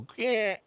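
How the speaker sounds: high-pitched imitation of a leg giving way; short high "grr" sound effect; imitated snapping noise. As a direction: make the noise of a leg buckling.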